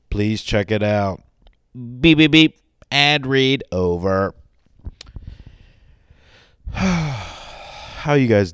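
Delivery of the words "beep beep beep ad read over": loud beeping announcement; mock robot beeps. Host signalling the ad read is over, loud mock beeps. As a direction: sound the beeps loudly, then announce.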